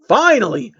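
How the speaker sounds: fed-up guard yelling at the prisoner; shouting. Acted. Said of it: "Shining Armor shouted at him".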